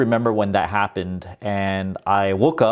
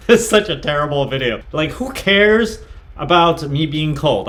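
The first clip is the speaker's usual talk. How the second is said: laughing, higher-pitched self-mockery; amused disbelief; 6 semitones higher, 4 dB louder than usual. Real, not acted. Creator watching his terrible first video, laughing in disbelief. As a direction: laugh through it, cringing at your own work.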